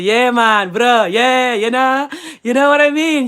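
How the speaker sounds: badly faked American bro talk; bad American accent. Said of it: "I can't do the American accent".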